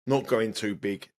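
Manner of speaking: ordinary delivery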